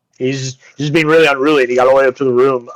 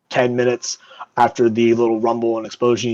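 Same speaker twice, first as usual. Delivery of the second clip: ordinary talk, nothing marked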